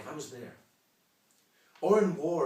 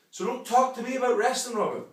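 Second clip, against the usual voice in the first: higher and louder, climactic retort; 8 semitones higher, 6 dB louder than usual. Acted. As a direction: hit it hard as the final blow. Rant at rival, climactic callback.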